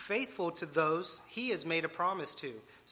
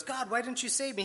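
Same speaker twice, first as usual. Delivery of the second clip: higher-pitched, acted plea to God; mock complaint; 7 semitones higher than usual. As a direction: protest as the wronged man in a joke. Drowned man complains to God.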